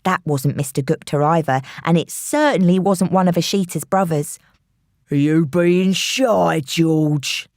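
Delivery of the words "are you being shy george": menacing man. A narrator's reading. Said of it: "said the first voice".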